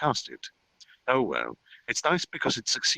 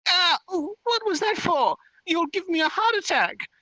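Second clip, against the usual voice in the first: very high, squealing fairy protest; indignant complaint. Acted. Fairy complaining after dodging a chop, indignant squeal. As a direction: squeal in outrage after a near miss. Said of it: "she complained after dodging".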